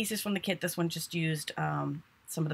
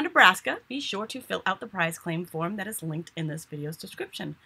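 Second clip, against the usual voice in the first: usual speaking voice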